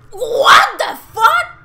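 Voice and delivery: high, loud, slow cry of disbelief; shocked shout. Real, not acted. Player suddenly killed in the game, loud shocked shout. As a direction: yell in disbelief.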